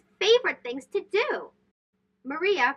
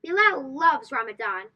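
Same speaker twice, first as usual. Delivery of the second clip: plain reading voice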